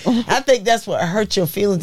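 normal talking voice

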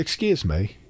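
a hint of a Liverpool lad; soft mock-Liverpudlian aside; Liverpool accent. Shown as a demonstration. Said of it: "which sounds a lot like my Liverpool".

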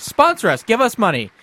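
high, loud appeal to sponsors; mock demand. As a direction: call out for cash, playfully insistent.

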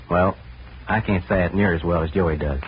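ordinary talk, nothing marked

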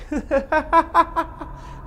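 high, distant creepy laughter; eerie ghost laugh. Retold or imitated. A ghost laughing down the hallway, high eerie laugh. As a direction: laugh like something at the end of the hallway. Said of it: "Just like that".

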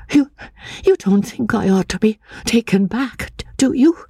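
put-on high, timid voice of a fearful girl; frightened young woman. A narrator's reading. Anne, fearing the asylum, asks the narrator in a high timid voice.

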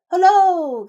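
loud, high drawn-out cry; hearty storybook shout; calling out. A narrator's reading. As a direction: call it out at full voice. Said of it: "cried he".

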